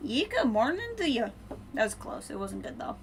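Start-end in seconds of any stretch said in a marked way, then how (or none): 0.1-1.3 a higher, half-hearted mock Irish greeting; Irish accent attempt. Shown as a demonstration. Streamer greets viewer in a clumsy mock Irish accent. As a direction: have a go at an Irish good morning. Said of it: "I can't really do an Irish accent".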